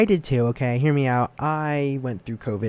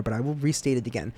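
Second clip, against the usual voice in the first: ordinary delivery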